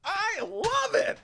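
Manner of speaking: very high, slow gushing coo; [name] voice. Retold or imitated. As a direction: coo it like a smitten judge. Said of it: "What was the lady's name".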